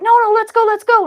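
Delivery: high, loud, insistent let's go; eager pleading. Acted. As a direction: beg a tired friend to keep going. Current urging lazy voltage.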